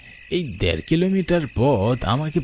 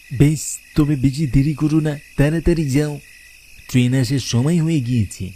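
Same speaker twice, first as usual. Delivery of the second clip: lightly voiced shopkeeper; 5 semitones higher than usual. Sweet-shop owner hurrying the young groom to his train, lightly voiced and urging. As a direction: nudge the young man along, lightly in character. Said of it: "মিষ্টির দোকানদার".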